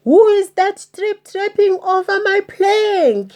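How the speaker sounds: high, loud mocking jeer. Pirate chief mocking the troll on the plank, jeering. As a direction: taunt him like he taunts goats. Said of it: "jeered Handkerchief".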